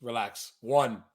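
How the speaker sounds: ordinary talk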